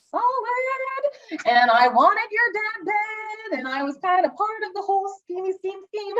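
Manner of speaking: high, hammed-up singsong confession; scheming mom. Mother confesses the plot to her son, hammed-up scheming mom.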